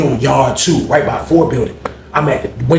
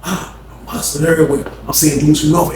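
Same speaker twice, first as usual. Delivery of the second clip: normal talking voice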